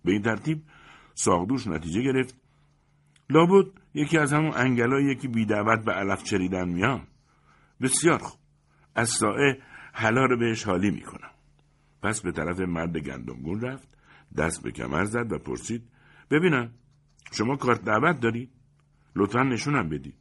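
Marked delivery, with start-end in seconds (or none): 3.3-11.3 high, loud indignant best man; best man deciding to expose the gate-crasher, louder. A narrator's reading. 16.3-20.2 high, loud officious demand; best man confronting the guest, louder and higher. A narrator's reading.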